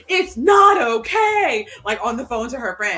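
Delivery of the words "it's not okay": loud, high, slow wail; mock distraught shout. Daughter on the phone to her friend, hammed-up loud wail. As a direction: let the teen's calm crack into a high cry.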